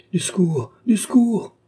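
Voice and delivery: high, loud cry for help. A narrator's reading. Old man reaching the surgeon, crying for help. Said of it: "cria le vieillard".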